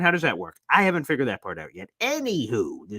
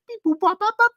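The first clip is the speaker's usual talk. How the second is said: much higher, beeping like a cartoon robot; robot beeps; 4 dB louder than usual. Explaining the Elroy nickname, squeaky robot beeps. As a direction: chirp out beeps like a Jetsons robot. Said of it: "the Jetsons".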